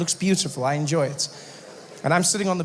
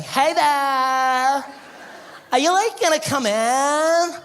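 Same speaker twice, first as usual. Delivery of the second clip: put-on high, slow airy California girl; valley girl voice; 8 semitones higher, slower than usual. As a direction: play the ditzy Malibu surfer girl. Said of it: "and she's like".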